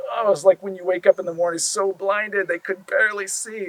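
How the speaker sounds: loud, bright and excited. A narrator's reading. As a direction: burst out with wonder. Vivid blinding light description.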